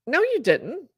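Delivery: high, rising denial in the wife's voice; retold disbelief. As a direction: imitate a wife refusing to believe it. Retold wife's shocked denial of confession.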